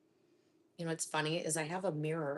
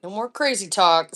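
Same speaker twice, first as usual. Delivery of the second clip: louder, stern mom warning; firm scolding; 12 dB louder than usual. Acted. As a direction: put your foot down, loudly. Mom scolding child at stove.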